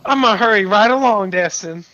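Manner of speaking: mock girlish eagerness; mimicking a girl. Player mimics the flustered girl.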